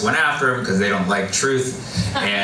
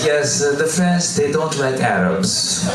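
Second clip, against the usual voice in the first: French local; 3 semitones higher, slower than usual. Retold or imitated. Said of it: "they're like".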